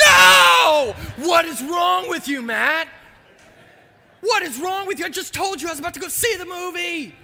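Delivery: very high, loud cry of disbelief; outraged yell. Retold or imitated. Pierce yells at his friend for spoiling the ending, outraged. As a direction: scream at him for spoiling it.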